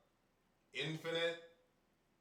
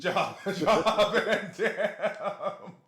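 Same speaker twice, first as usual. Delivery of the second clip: laughing; 4 semitones higher, 7 dB louder, much slower than usual. Real, not acted. Laughing at the joke name.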